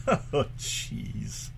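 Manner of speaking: ordinary talk, nothing marked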